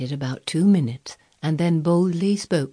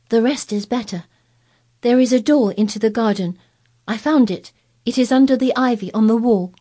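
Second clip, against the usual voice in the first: louder, eager secret-telling; solemn excitement; 6 semitones higher, 6 dB louder than usual. A narrator's reading. Mary revealing the garden door, in solemn excitement. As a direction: lean in to share the big secret.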